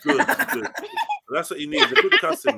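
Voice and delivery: words through laughter; laughing. Real, not acted. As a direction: laugh while saying it. Laughing at Randy's scolding.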